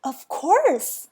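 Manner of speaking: excited exclamation. Acted. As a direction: burst out with sudden realisation. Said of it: "cried Mister Happy".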